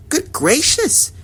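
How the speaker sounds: very high, slow startled gasp; Maisie gasping. A narrator's reading. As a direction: gasp it out in shock. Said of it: "gasped Maisie".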